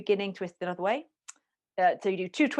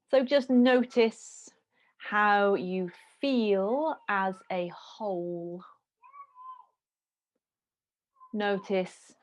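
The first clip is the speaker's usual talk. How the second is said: guided meditation. Acted. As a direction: guide them into stillness, slowly. Leads a meditation.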